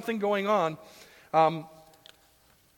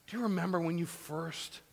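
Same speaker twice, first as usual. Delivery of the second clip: ordinary talk, nothing marked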